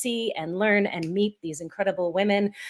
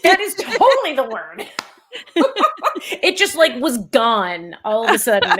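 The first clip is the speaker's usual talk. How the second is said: laughing through the words, higher and louder; 4 semitones higher, 6 dB louder than usual. Real, not acted. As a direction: giggle it out at your own blank. Laughing at the forgotten word.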